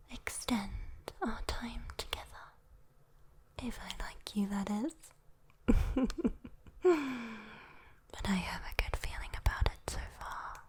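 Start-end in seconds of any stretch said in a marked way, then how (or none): 5.7-7.5 hushed giggle trailing into a hum; teasing demon chuckle. Acted. Demon teasing whether it likes listener.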